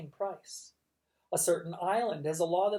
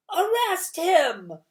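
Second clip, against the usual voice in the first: very high, loud, slow yell; audience shout; 13 semitones higher, 12 dB louder, much slower than usual. A narrator's reading. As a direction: yell from the crowd. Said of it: "a voice said from the audience".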